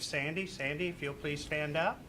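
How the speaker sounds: normal talking voice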